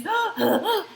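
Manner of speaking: a sharp, high gasp for air like a panicked diver; imitated panicked gasp. Instructor imitates the hyperventilating diver on the boat, sharp high gasp. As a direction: gasp in, mimicking her breathless panic. Diver gasping after near drowning.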